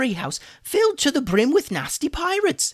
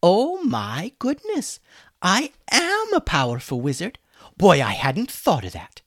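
mocking; 9 semitones higher, 5 dB louder than usual. Hummus mocking Julian's idea, sarcastic. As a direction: play dumb, dripping with sarcasm. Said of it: "mocked Hummus".

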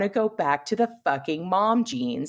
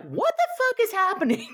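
high, panicked mock confusion; alarmed Vision. Vision reacting to the sudden kids, high panicked voice. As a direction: squeak it out in disbelief. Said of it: "Vision's like".

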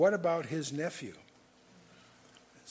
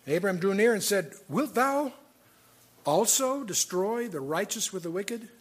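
measured, solemn Bible reading, a touch louder; scripture reading; 3 semitones higher, 4 dB louder, slower than usual. Acted. Preacher reading Genesis to his congregation, solemn scripture reading. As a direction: read the verses out with weight. Reads Genesis aloud in sermon.

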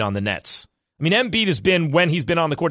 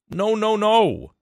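emphatic no; 6 semitones higher, much slower than usual. Real, not acted. Rejecting the list.

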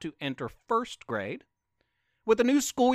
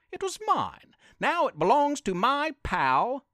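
a hint of a higher, squeaky frog; lightly voiced frog; 6 semitones higher than usual. The frog tells the dog, lightly voiced and higher. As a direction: give the frog a little lift of protest. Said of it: "said a green frog".

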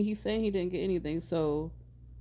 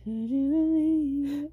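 mock high drawn echo; 8 semitones higher, slower than usual. Echoing mock squeal.

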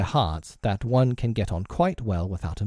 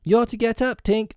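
put-on high-pitched boy calling out; 13 semitones higher than usual. A narrator's reading. Nibs waking Tink in bed, calling high. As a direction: call to her in a high boy's voice. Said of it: "Nibs called".

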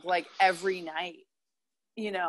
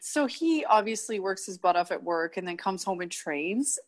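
usual speaking voice

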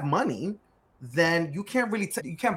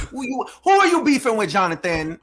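teasing team question; 7 semitones higher, 8 dB louder than usual. Retold or imitated. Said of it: "Then they'll ask me".

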